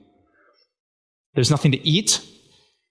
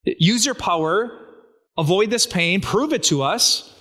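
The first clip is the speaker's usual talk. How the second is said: lightly mocking taunt, higher and partly slower; mocking voices; 5 semitones higher than usual. Enemies taunting Jesus on the cross, lightly mocking. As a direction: sneer up at the man on the cross. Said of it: "Do you remember their mocking voices?".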